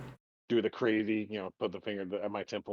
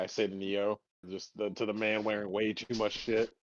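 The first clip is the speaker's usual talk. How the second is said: ordinary talk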